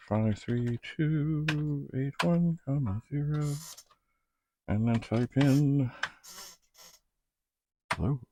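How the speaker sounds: normal talking voice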